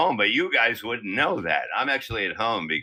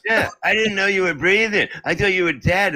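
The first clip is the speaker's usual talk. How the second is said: high, louder fretful wife voice; mock worried wife; 9 semitones higher, 3 dB louder than usual. Wife who thought he was dead, high fretful mock. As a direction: play his wife, fussing over him.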